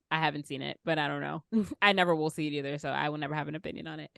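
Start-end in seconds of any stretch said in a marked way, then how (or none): none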